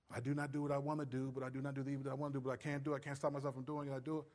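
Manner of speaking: soft, low, hurried quotation; rattled-off recital. Acted. Preacher reciting Paul's struggle as illustration, soft rattled-off recital. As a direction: rattle it off under the voice.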